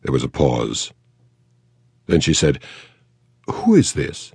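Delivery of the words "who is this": sharp, higher English question; wary, well-bred woman on the phone; Mayfair accent. A narrator's reading. Actress wary of the caller, higher Mayfair accent. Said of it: "the Mayfair accent".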